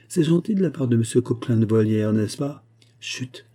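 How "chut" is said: very soft warning shush; hushing. Acted. Hushing Porthos's noise at night. (chut à voix très basse)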